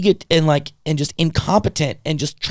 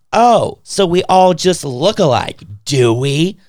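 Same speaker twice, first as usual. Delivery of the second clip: insinuating; 3 semitones higher, 4 dB louder, slower than usual. Retold or imitated. Nurse accusing patient of racism, slow and insinuating. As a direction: imitate an offended nurse, drawn out and accusing. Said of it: "she was just, like, insinuating".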